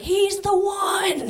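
slow, high, solemn mock line; mock movie intonation. Mocking The Matrix characters, slow and grand. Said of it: "They're like".

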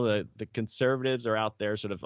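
usual speaking voice

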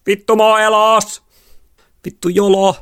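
very high, loud whooping yell; exhilarated shouting. A narrator's reading. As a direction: scream it out on a wild rush. Exhilarated shouting in careering car. (riemukas kiljuminen)

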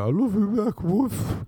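high, silly talking-dog voice. Retold or imitated. Dog people voicing their pets, high put-on dog voice. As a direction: play the pet answering, ending on a woof. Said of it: "dog people who'd be like".

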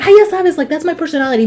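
high mock mom voice, lightly voiced; a hint of mom defending herself; imitating mom. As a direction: daughter quoting her mother. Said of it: "'Cause she's like".

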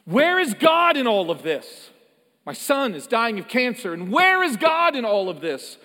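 a grieving father, angry at God, demands where God is, shouting; retold angry outcry. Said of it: "very angry at God".

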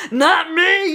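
high, loud comic protest; mock refusal outburst. Comic outburst about emotional readiness.

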